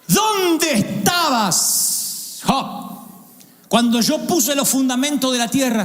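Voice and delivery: high, very slow, drawn-out divine question; God confronting Job, drawn out and high; God's challenge. Acted. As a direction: stretch out the first words like a booming God. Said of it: "Lo voy a leer tal cual".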